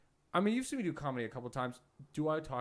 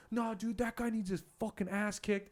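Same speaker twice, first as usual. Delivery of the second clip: higher-pitched mock rant; retold angry outburst; 8 semitones higher than usual. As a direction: imitate a worked-up bartender. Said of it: "this guy is, like, freaking out".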